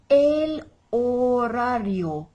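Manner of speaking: slow "horario", H unsounded, R's rolled; silent H, rolled R's. Shown as a demonstration. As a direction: model "horario" slowly as o-RA-rio with Spanish R's. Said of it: "with a silent H".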